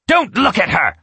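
sharp, high, loud snap; snapped. A narrator's reading. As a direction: bite the words off, furious. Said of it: "Natasha snapped".

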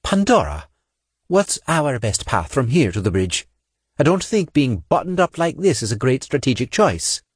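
a touch of exasperated officer; weary squad leader, mildly fed up; frustrated commander. A narrator's reading. Frustrated commander after sigh.

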